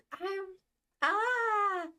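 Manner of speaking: high, loud, happy squeal and laugh; giddy laugh. Real, not acted. Fan giddy over artist, squealing laugh. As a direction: burst out giggling with joy. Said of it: "I'm so happy".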